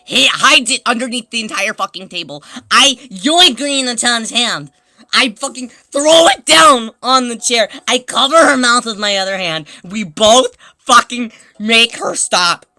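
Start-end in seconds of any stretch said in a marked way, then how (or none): 2.7-12.7 loud, forceful narration; worked-up, emphatic shouted storytelling; frantic retelling. Real, not acted.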